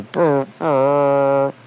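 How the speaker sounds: imitating an air horn, brr; truck horn imitation. Shown as a demonstration. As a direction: buzz out a horn honk. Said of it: "they'll honk their horn for you".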